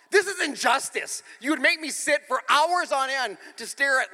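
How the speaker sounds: retold, hammed-up indignation; indignant protest. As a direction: protest loudly like a wronged child. Child protesting, acted out.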